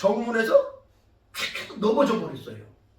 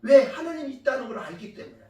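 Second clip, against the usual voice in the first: normal talking voice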